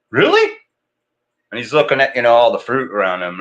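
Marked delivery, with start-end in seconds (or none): 0.1-0.6 put-on high toddler "Really?"; squeaky little kid asking; dad voicing his son, much higher; imitating toddler. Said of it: "And he's like".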